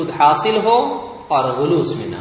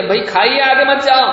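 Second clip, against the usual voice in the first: raised, higher warning shout; acted shout, building louder to save someone; urgent warning call; 7 semitones higher, 5 dB louder, faster than usual. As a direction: call out to stop someone far off. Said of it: "اس کو بلا رہے ہیں آپ بچانے کے لیے".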